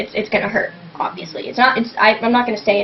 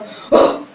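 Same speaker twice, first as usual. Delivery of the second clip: rasping gasp. Shown as a demonstration.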